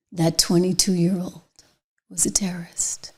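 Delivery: hushed, contemptuous whisper; disgusted whisper. Real, not acted. Host condemning the shooter, in a disgusted whisper.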